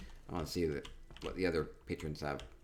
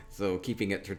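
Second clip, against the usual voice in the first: normal talking voice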